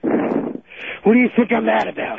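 high, winded, angry retort; slow, panting indignation; out of breath. Real, not acted. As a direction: fire back between heavy breaths. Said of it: "Why are you still out of breath?".

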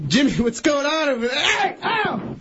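high, mock-worried calling out to the caller; mock alarmed call. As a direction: call out to Jimmy in pretend alarm. Host calling to hurt caller.

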